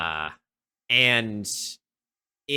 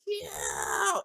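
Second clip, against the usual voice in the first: mock yell; 9 semitones lower, 4 dB quieter than usual. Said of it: "Maureen is like".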